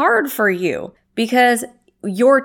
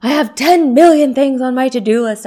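overwhelmed complaint; 4 semitones higher, 7 dB louder than usual. Retold or imitated. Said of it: "I will tell my husband".

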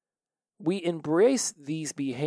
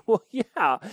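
ordinary delivery

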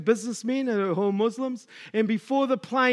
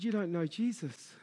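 tearful; 3 semitones lower, 12 dB quieter than usual. Retold or imitated. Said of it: "I just start crying".

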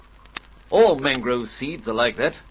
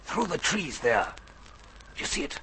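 urgent resistance whisper; 8 dB quieter than usual. Acted. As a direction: hush it, danger is near. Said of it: "Keep your voice down".

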